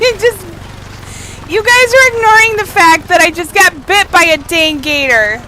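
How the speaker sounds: high, loud indignant complaint; indignant shout. Real, not acted. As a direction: protest loudly, outraged. Indignant after gator bite.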